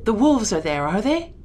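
loud question, climbing high at the end; forceful alarmed question; roused cry. A narrator's reading. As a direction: demand it loudly, freshly alarmed. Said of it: "cried my father".

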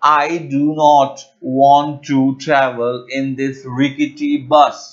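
slow, clear teaching read of the sentence; slow dictation-style example. Shown as a demonstration. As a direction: lay out each word slowly for learners. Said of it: "So the example is".

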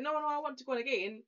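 high, eager mock kid voice; imitated insistent child. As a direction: mimic a child insisting keenly. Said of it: "She went".

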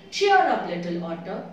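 a loud, bright burst of encouragement from the little bird; cheerful baby bird. Acted. Baby bird cheering up the little otter, loud encouraging call. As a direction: call out to cheer a sad friend up. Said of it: "said the baby bird".

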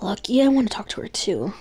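reading game dialogue. Acted. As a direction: read the visual novel lines aloud, a touch acted. Reading game story dialogue aloud.

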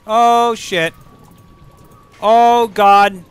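high, loud dismayed exclamations; loud alarm. Real, not acted. Streamer in sudden danger, loud alarmed cries. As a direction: cry out as things go wrong.

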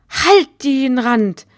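loud, slow furious bark; angry shout. Acted. Reagan yells at Sophie to shut up. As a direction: cut her off hard.

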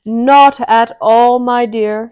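slow, loud drawn-out uncle. Acted. Uncle bunny gently overruling his wife, slow and loud. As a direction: stretch out a fond objection.

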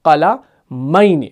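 قلمین drawn out slowly as qalaa-maini; slow demonstration. Shown as a demonstration. Teacher showing what the word becomes, very slow قلمین. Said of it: "کیا بنے گا؟".

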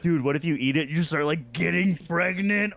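roasting a kid, hammed-up; mocking friends. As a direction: imitate kids teasing, pitch climbing high. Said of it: "they're just making so much fun of me".

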